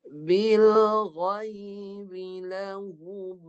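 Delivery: "بِالْغَيْبِ" with a voiced, heavy ghain from the tongue root; teacher modelling the verse; recitation demo. Shown as a demonstration. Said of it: "Dengar saya baca".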